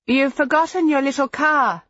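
calling across the room. A narrator's reading. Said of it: "she called".

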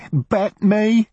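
high, sharp woman's voice; a woman snapping back, pitched high. A narrator's reading. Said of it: "said the woman".

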